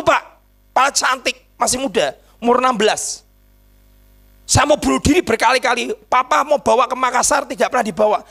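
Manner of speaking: ordinary talk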